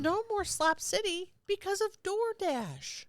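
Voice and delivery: high, slow hammed-up lament; playful mock grief; mock dramatic. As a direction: announce it like a tragic decree.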